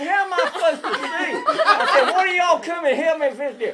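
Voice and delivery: high, loud, fast exasperated call; laughing yell. Retold or imitated. Storyteller yelling at friends who ran off, laughing. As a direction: yell up at them, half laughing.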